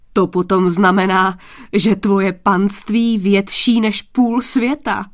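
loud, mocking royal disbelief; loudly amused. A narrator's reading. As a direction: scoff loudly at the stranger's boast. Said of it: "Smál se už hlasitě".

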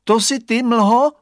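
put-on high, loud child call; boy calling. A narrator's reading. Boy calls into fog, high and loud.

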